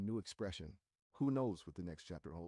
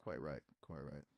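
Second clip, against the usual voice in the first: English accent; 6 semitones lower, 5 dB louder than usual. Shown as a demonstration. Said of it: "English accent".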